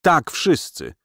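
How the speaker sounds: understated angry outcry; mild cry. A narrator's reading. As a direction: cry it out, stopping short of a full shout. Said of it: "krzyknęła".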